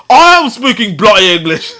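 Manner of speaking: high-pitched put-on English accent; mock English accent. Mocking an American classmate's retort, fake English accent. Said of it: "that's probably a better English accent".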